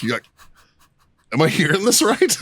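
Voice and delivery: retold high-pitched disbelief; incredulous. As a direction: act out not believing your ears. Retelling his disbelief at the confession.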